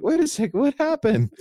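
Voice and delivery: high, shocked double take; surprised. Retold or imitated. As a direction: squeak out the shock. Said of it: "they're like".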